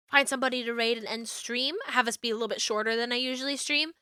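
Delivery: usual speaking voice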